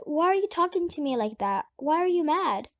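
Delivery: usual speaking voice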